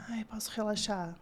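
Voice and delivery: soft, whispered sigh of relief; relieved sigh. Retold or imitated. Nutritionist voicing a parent finally allowed to relax, relieved whisper. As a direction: let it out on a hushed breath.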